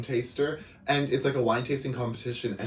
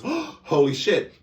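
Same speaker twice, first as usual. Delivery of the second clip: drawn-out, higher, louder stunned reaction; shocked gasp; 6 semitones higher, 6 dB louder, much slower than usual. Retold or imitated. Tarot reader learning a viewer lost a finger, slow stunned gasp. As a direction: let the shock hang on each word.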